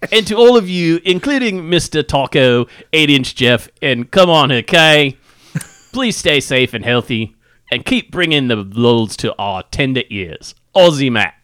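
put-on Aussie accent; Australian accent. Reading Aussie listener's mail on a podcast, mock Australian accent. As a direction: read it as a hammed-up Australian. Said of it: "read it in an Australian accent".

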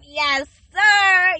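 usual speaking voice

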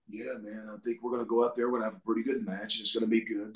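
quiet, low offstage wrestler voice; Macho Man. Acted. Wrestler chatting before a match in person, low-key imitation. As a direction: play it low-key and soft, just chatting. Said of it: "when you're around him in person".

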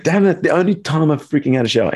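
high, panicky mock exasperation; frantic high-pitched grumble; flustered panic. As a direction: pitch it up like you're caught mid-shower. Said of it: "I'm like".

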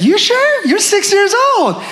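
high-pitched disbelieving question; incredulous. Retold or imitated. Dad to daughter asking to be held, incredulous.

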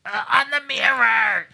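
put-on high, slow little-kid answer; small child voice. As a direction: call it out like an eager little kid. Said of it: "And I'd be like".

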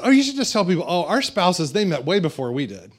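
1 wife's suggestion. Retold or imitated. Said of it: "she said".

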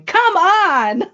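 high, loud exasperated shout; yelling. Retold or imitated. Fan yelling at the Twitter keyboard during a match, yelling. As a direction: re-enact yelling at the screen. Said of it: "I was yelling at my Twitter keyboard".

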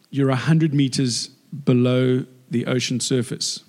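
ordinary delivery

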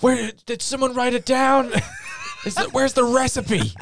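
high, frantic, laughing cook voice; panicked high cook voice. Retold or imitated. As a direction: squeak it out in a flustered panic. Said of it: "they're like".

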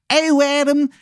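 very high, loud Baltimore wizard squawk; horrible Maryland accent. Acted. Game master demonstrates the wizard's voice, horrible Maryland accent. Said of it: "idea of what that sounds like".

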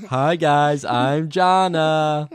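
blaring high mock kid greeting; Jana. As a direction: belt out a slow, stretched child vlogger intro. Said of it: "I sounded exactly like you".

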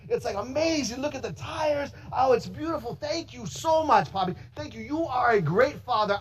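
put-on a boy thrilled over a new bike; excited son. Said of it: "And Noah's like".

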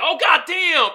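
a sudden shout at the screen; excited exclamation. Retold or imitated. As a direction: blurt it out, impressed. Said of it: "I was like".

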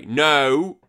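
sharp loud exclamation of refusal. Real, not acted. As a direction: shout a quick no. Reacting loudly to message.

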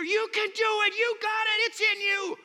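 high, loud pep chant; mock pep cheer. Quoting the crowd's cheers, high and loud. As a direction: shout it like the cheering kids.

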